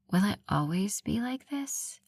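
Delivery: drowsy, whispered question; sleepy whisper. Acted. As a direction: ask half asleep, under breath. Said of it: "my voice heavy with sleep".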